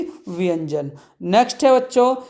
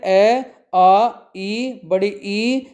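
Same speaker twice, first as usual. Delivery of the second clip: slow recitation; much slower than usual. Shown as a demonstration. Drawn-out vowel recitation.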